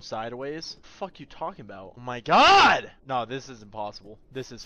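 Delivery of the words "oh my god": yell. Real, not acted. Frustrated yell.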